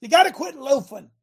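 high, loud coaching shout; coach yell. Retold or imitated. Coach yelling at football team, loud and high. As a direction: bark it at the team. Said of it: "if I yell at the team".

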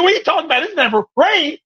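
high disbelieving neighbors; mocking crowd. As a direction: scoff at the idea of rain, pitch rising. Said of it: "They said".

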